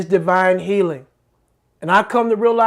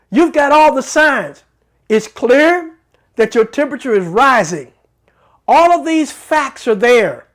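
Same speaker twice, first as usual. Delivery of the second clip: pitched up, louder, rising with conviction; preaching at full force; 3 dB louder than usual. Acted. Preacher to his congregation about ignoring symptoms, fervent build. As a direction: hammer home each point like a pulpit climax.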